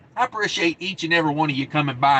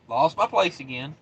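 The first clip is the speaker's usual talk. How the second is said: ordinary talk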